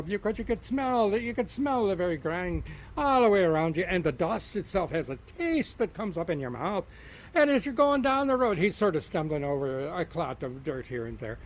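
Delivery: loud, animated Irish-tinged story telling; lively storytelling. Acted. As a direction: spin the tale big for the crowd. Storyteller tells leprechaun tale.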